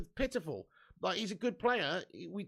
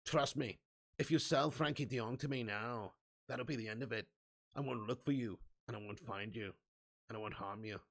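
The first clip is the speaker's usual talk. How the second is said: lower, menacing Northern Irish Liam Neeson voice; 7 semitones lower, 6 dB quieter than usual. Retold or imitated. Ten Hag on the phone threatening Barcelona, lower Northern Irish Liam Neeson voice. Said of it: "it's a terrible Northern Irish accent".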